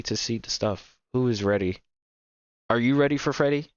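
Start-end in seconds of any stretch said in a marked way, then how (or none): none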